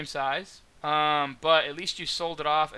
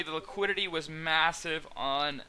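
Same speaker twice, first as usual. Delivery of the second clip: normal talking voice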